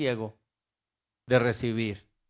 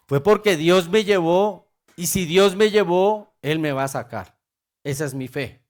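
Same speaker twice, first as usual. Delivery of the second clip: ordinary talk, nothing marked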